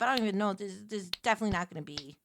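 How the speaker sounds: ordinary talk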